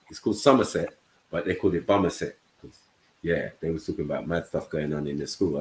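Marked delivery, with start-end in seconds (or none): none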